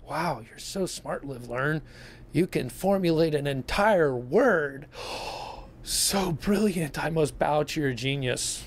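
slow mock admiration with a big fake gasp; sarcastic awe. Mocking a commenter's one-word comment, sarcastic awe. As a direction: gush slowly as if awestruck, dripping sarcasm.

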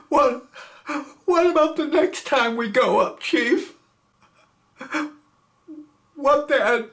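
high, anxious pleading; frightened. Acted. Scared gunner asking the chief about next flight. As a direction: beg the chief for an answer. Milstein still upset.

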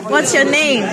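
ordinary talk, nothing marked

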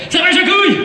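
high-pitched showman's shout; exaggerated, high call to a stooge. Hypnotist calls out trigger name.

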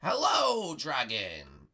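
loud high hello, dropping on dragon; calling out. Pretend. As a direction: hail it with a long swooping hello. Calls out to the dragon.

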